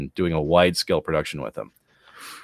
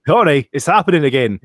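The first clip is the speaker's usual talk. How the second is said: worried husband voice; 6 semitones higher, 7 dB louder than usual. Retold or imitated. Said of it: "laughs before voicing Joe".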